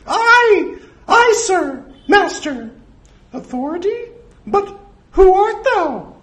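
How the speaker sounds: squeaky, very high falsetto; high-pitched nervous mouse voice; Mickey. Acted. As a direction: answer the master in a cowed cartoon squeal.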